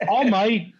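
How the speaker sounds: high mock-Australian exclamation; Australian accent. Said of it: "I don't do Australian accent real well".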